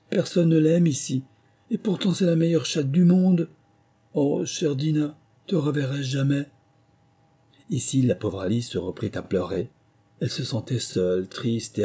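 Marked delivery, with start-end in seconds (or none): 0.1-6.5 high, plaintive lonely lament; sad. A narrator's reading. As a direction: plead for the absent pet, pitched up. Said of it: "se dit-elle tristement".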